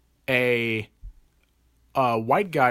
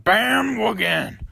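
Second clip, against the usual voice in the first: high, loud, stretched-out goofy holler; Jim Carrey; 9 semitones higher, 6 dB louder, much slower than usual. Retold or imitated. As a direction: kid imitating a movie catchphrase at full volume. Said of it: "I was imitating it".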